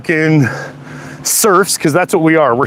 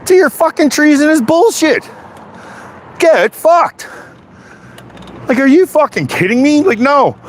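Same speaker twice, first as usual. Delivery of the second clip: high-pitched, indignant cursing; angry outburst; 9 semitones higher than usual. Real, not acted. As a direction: curse them out, voice pitched up. Angry rant at a treasonous opponent.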